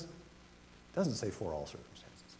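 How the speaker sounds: hushed, slow whisper of "It doesn't say for all circumstances"; slow hushed whisper, "doesn't" stressed. Shown as a demonstration. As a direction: drop to a confiding whisper, slowly. Preacher drops to a hush.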